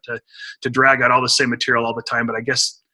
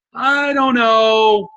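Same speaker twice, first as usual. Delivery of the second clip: drawn-out doubt; 7 semitones higher, much slower than usual. Retold or imitated. Listener losing motivation, imitated.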